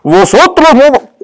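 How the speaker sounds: slow, drawn-out preacher formality; lightly mocked old-style pulpit Spanish. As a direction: parody the stiff pulpit "vosotros". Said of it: "Cuando hablamos así".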